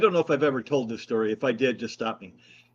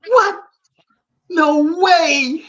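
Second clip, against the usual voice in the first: high, loud star-struck disbelief; hammed-up shock, pitched way up; retold amazement; 9 dB louder, much slower than usual. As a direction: imitate an astonished fan crying out. Imitating the fan's amazed reaction.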